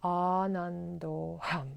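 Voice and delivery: slow, level, settled chant; drawn-out closing mantra; final mantra repetition. Shown as a demonstration. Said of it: "One more time".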